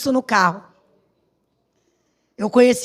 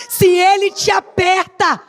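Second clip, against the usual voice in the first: fervent preaching; 5 semitones higher than usual. Acted. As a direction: preach it hard to the church. Climactic preaching. (pregação fervorosa e crescente)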